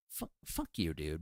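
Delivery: muttered envy. Retold or imitated. Fan watching an actor switch accents, jealous mutter. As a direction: grumble it under the breath.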